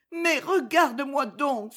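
supplique aiguë et lente; cri implorant. A narrator's reading. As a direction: implorer un regard, voix haut placée. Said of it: "s'écria-t-elle". (exclamation plaintive de femme)

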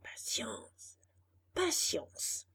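soft, fading whisper. Acted. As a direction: whisper it to yourself. Donkey murmurs to himself.

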